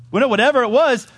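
loud, high, punched-out declaration; emphatic preaching. Real, not acted. Preacher repeating the point to congregation, loud emphatic preaching.

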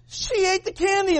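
put-on high-pitched kid tattling; mock child's complaint; tattling little boys. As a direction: whine it up like an outraged little boy. Boys tattling in candy story.

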